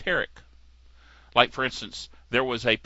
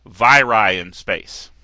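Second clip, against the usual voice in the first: mock announcer; 5 semitones lower, 6 dB louder, much slower than usual. Echoing the announcer bit.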